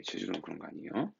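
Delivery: soft sing-song repeat; soft playful repeat. Shown as a demonstration. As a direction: tease it gently, rising on 아니에요. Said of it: "참고로".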